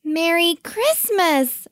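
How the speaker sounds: high, slow, careful greeting; polite chorus. A narrator's reading. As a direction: echo the greeting politely, drawn out. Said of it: "murmured the Kiwis politely".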